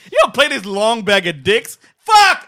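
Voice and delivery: loud, high hammed-up indignation; mock outrage. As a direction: yell at your cohost in fake disgust. Mock outrage at cohost's pick.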